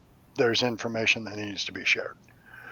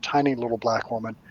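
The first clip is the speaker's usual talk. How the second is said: normal talking voice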